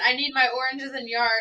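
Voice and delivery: a hint of yelling at him; half-laughing mock yell; mock screaming. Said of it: "screaming at him".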